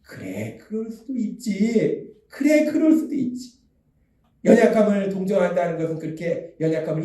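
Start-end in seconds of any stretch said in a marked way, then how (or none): 0.0-3.6 God's gentle acceptance. Acted. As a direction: draw it out forgivingly, lifting on the repeat. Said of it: "하나님이 오늘 우리에게 또 말씀하시는". (천천히 너그럽게 받아 주는 말투)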